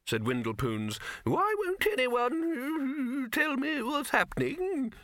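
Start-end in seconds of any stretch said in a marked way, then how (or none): 1.2-4.9 put-on very high, slow, plaintive old man; fretful old wizard. Old wizard pleads with the others, fretful and doddery. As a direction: whine it in a quavering old voice.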